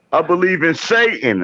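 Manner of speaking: high, put-on voice for a devout believer; mock believer. To the friends, mimicking someone professing belief in a high put-on voice. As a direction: squeak it out like a different person entirely. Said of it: "you can be like".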